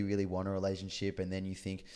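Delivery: usual speaking voice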